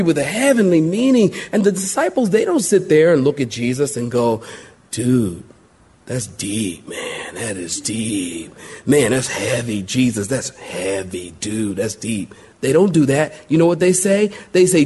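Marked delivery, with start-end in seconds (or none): 4.9-12.3 a dazed dude mumbling; laid-back surfer dude. Pretend. Disciples voiced as surfer dudes.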